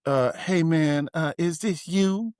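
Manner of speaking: mock a celebrity's puzzled question. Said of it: "looks at me and says".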